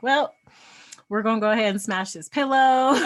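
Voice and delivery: hammed-up cheerful vow to smash the pillow; mock cheerful announcement. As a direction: announce it brightly like it's no big deal. Acted cheerful decision to partner.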